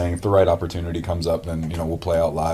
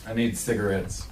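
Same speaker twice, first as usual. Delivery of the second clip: laughing; 13 semitones higher, 3 dB louder than usual. Real, not acted. Joking aside, then laughs.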